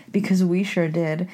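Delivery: usual speaking voice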